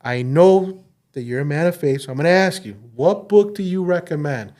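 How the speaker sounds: loud, high earnest asking; lightly voiced coworker asking; earnest coworker request.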